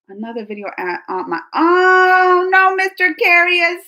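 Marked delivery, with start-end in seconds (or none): 1.5-3.8 loud, drawn-out oh no; dismayed. Real, not acted. Replying to a viewer's comment, loudly dismayed. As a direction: stretch out a high, loud lament. Reacts to another person's message.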